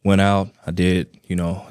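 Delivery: ordinary delivery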